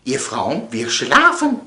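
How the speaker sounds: usual speaking voice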